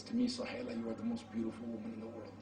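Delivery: soft, fast whispered reassurance; tender whisper. Acted. Husband whispering to his aging wife that she is beautiful. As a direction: hush it to her like a secret.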